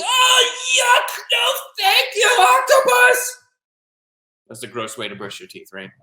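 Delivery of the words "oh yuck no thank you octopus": put-on very high disgusted boy squeal; grossed-out shout. Boy recoils from paintbrush toothbrushing, grossed out. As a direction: shriek it in comic disgust. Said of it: "he's so grossed out".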